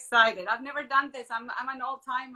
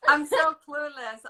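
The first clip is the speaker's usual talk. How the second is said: loud apology; 4 semitones higher, 10 dB louder, slower than usual. Real, not acted. Guest apologising on joining.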